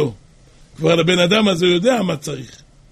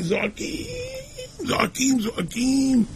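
imitated crying out; 7 semitones higher, 8 dB quieter than usual. Husband tells how he and his wife cried out, imitated wailing. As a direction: imitate a couple wailing from afar, high and held. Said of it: "זעקות נוראות".

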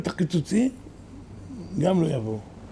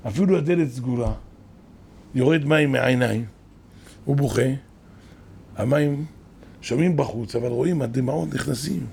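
ordinary talk, nothing marked

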